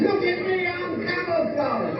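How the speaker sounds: high, slow, pompous soldier boasting; proud redcoat. Pretend. Comedian acting a redcoat proud of his camouflage. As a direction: play a vain redcoat showing off his disguise.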